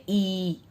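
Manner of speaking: ई held long and steady as "eee"; long ee vowel. Shown as a demonstration. Teacher demonstrates उच्चारण of बड़ी की मात्रा, long ee vowel. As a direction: stretch ई out as one sustained ee. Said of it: "इसका उच्चारण करते".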